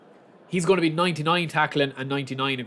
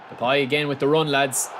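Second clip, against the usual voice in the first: usual speaking voice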